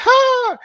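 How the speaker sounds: very high, loud mock laugh; one squealed falsetto laugh, put on; Zendaya's laugh. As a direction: imitate a piercing girly "ha". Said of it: "what the heck she was laughing at".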